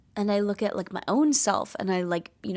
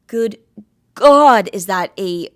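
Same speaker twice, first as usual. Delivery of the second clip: much louder, exasperated outcry; loud exclamation; 13 dB louder, slower than usual. Real, not acted. About bride beauty standards, loud exasperated outcry. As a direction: blurt it out in disbelief.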